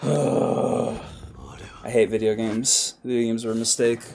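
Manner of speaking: slow, low, deflated grumble; defeated sigh. Real, not acted. Gamer sighing in defeat after failing, slow and low. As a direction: sigh it out, beaten.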